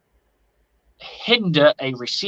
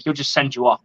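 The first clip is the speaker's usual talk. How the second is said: ordinary talk, nothing marked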